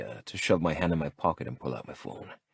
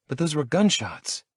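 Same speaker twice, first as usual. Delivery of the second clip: higher, innocent disbelief; wide-eyed innocent; 10 semitones higher than usual. Acted. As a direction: play the naive innocent. Talia protests, wide-eyed.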